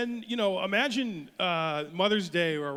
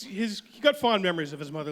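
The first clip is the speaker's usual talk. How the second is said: ordinary talk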